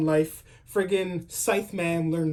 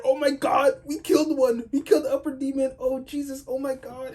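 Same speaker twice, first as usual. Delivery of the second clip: high-pitched mock freak-out; mad excited; 9 semitones higher than usual. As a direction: imitate a guy losing it with excitement. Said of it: "this guy was mad excited".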